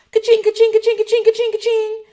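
high, loud rapid ka-chings; repeated loud high cha-ching imitation; cash register sound. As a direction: ring up the money in a shrill-pitched panic.

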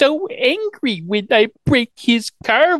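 put-on helpless baby voice; mock baby talk. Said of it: "she's baby talking".

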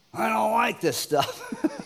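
high, slow whine; whiny complaint. Retold or imitated. Preacher mimics grumbling Israelites, high and slow whiny.

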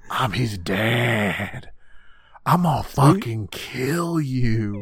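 slow, heavy mock-menacing voice; a hammed-up butler vowing to kill; menacing threat. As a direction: drag each word out like a threat. Acting out Goto's threat.

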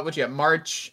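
usual speaking voice

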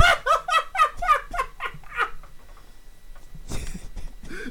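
high helpless laughter; cracking up hard; big laugh. Real, not acted. Cracking up at the joke.

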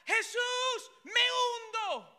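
loud, high cry for help, stretched out slow; panicked shout. Acted. Peter shouting to Jesus as he sinks, loud and high. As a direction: yell it like a man going under. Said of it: "Pedro empezó a gritar".

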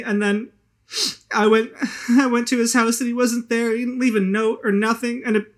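high, loud and near tears; tearful drunk woman. Acted. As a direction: pour out the hurt, close to crying. Hazel breaks down.

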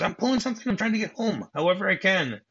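high-pitched exasperated protest; mock indignant retort. Husband accused by his wife, indignant. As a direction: retell a protest, voice pushed up high.

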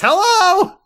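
Amy barging into the garage, high and loud; excited greeting. Acted. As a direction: burst in cheerfully.

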